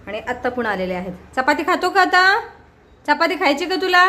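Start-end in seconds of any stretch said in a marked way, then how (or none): 1.4-4.0 coaxing a crow. Real, not acted.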